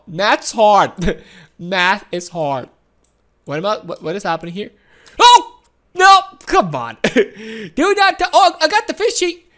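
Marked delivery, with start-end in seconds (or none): 5.2-6.2 sudden loud high "Oh! No!"; startled yelp. Real, not acted. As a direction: jolt at the sudden danger. Sudden danger in game.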